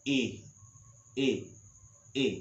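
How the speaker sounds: isolated brief ई, paused between; short i sound. Shown as a demonstration. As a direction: say ई short, one at a time. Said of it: "इस तरह का". (छोटी ई, रुक-रुक कर)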